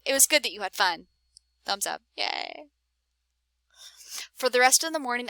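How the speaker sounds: ordinary delivery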